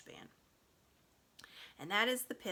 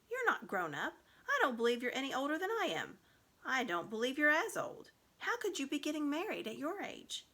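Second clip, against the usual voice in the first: Shasta objecting; 6 semitones higher, 4 dB louder than usual. A narrator's reading. Shasta challenges Aravis.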